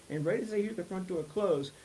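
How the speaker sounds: usual speaking voice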